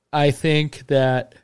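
stretched out through a yawn. Real, not acted. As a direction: say it mid-yawn, slow and drawn. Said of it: "I use that yawn to think".

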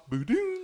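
high playful sound effect; imitated gadget beep; switch-off sound. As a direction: pop it like a click.

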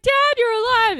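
high, loud and delighted; hammed-up joyful cry; mock happy son. Son greeting father back from the dead, high and loud. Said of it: "Gohan's like".

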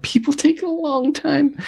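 high slow complaining voice; mock high whine. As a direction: whine it in a squeaky voice. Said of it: "And I'm like".

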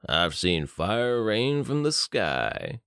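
very slow, dazed drawl. Acted. Benny drifts in a drugged daze, slow drawl. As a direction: stretch it out, lost in a haze. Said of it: "he drawled".

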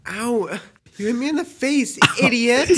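put-on high, whiny boyish complaint; kid voice yelp. Reenacting being hit in the face as a kid, high whiny complaint. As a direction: squeak it out like a hurt little kid. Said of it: "Just like".

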